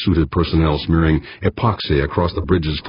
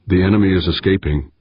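plain reading voice

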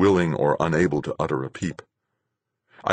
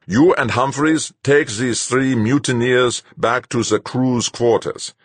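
commanding; 8 semitones higher, 5 dB louder than usual. A narrator's reading. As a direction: issue the captain's orders to the crew. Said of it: "the captain commanded".

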